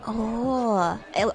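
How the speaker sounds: ordinary talk, nothing marked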